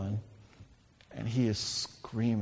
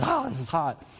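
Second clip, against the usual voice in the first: mock realizing it's hot; 4 semitones higher than usual. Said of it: "half a second to realize".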